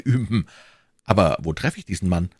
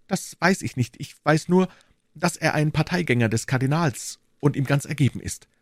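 lightly voiced higher-pitched townsman; a hint of a nervous informant; anxious bourgeois; 6 semitones higher than usual. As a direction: voice a worried citizen, lightly raised in pitch. Narrator plays the bourgeois.

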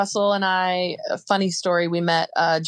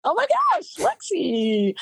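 excited outburst; 13 semitones higher than usual. Real, not acted. Hearing her friend's name announced.

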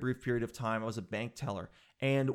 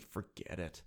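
soft resigned forget it; 8 semitones lower, 11 dB quieter, slower than usual. Retold or imitated. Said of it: "And I'm like".

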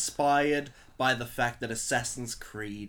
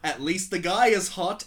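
slow, louder, sneering read; mocking read; 6 semitones higher, 10 dB louder, slower than usual. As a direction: read it out with scorn. Reading silly comment aloud.

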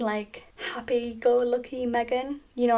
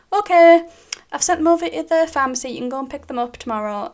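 brisk doctor voice; 5 semitones higher, 9 dB louder, faster than usual. Retold or imitated. Doctor voiced in retold call.

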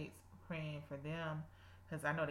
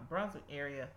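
ordinary delivery

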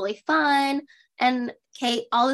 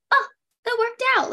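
high, delighted mock discovery; mock amazement; 11 semitones higher, 4 dB louder than usual. Mimicking a mom amazed by high chairs, mock amazement. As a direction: marvel at something obvious, pitched way up. Acts out Kate's amazed reaction.

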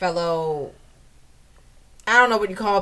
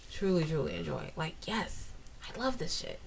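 very soft, savoring aside; hushed relish; 6 semitones lower, 16 dB quieter than usual. Real, not acted. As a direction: drop right down in volume to savor it. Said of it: "I say it like that".

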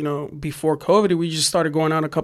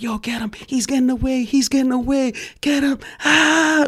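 high-pitched mock scream; retold screaming; 9 semitones higher than usual. Cops chase painters with flashlights, high retold screaming. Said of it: "like, screaming".

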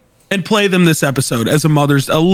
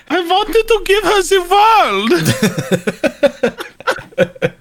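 high put-on voice again; hammy dictator whine; Hitler impression; 12 semitones higher than usual. Repeats joke line.

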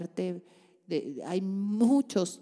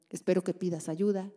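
ordinary delivery

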